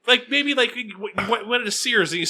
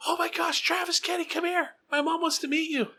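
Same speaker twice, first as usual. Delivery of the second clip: high, breathless gushing fan voice; 12 semitones higher, 5 dB quieter than usual. Retold or imitated. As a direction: squeal it like an excited fan meeting a local celebrity. Fan excitedly calling him over.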